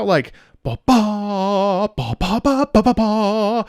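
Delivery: each ba struck with emphasis; sung rhythm with accented notes; emphasized beats. Shown as a demonstration. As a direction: hit every syllable like an accent. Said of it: "there's an emphasis?".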